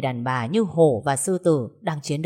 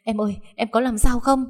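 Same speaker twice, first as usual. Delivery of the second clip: frightened; 5 semitones higher than usual. Acted. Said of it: "sợ hãi".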